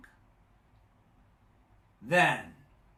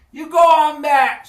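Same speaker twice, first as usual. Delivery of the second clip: much higher and louder victory shout; triumphant yell; 12 semitones higher, 16 dB louder than usual. Acted. Charlie yelling after the sunken sea serpent, triumphant shout. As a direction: crow it after the knockout.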